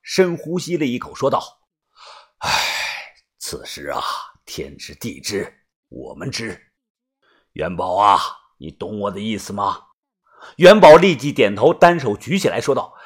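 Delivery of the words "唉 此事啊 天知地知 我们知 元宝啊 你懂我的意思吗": very low, hushed boss voice; secretive gang leader. Acted. Boss swearing Yuanbao to secrecy, low and hushed. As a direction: confide it softly and low, pressing for silence.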